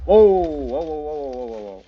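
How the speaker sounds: real startled whoas, loud then fading; startled alarm. Real, not acted. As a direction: caught off guard mid-game. Creepers drop on the player.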